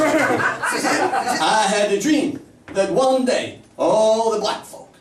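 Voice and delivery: put-on slow, high, drawn-out speech in a Northern accent; a Northern accent in a slow grand oratory; hammed-up Martin Luther King done in a Northern accent. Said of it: "Martin Luther King was Northern".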